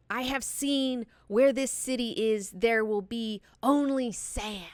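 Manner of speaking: mock screaming prophet. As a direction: play an entranced visionary proclaiming doom. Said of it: "he starts screaming".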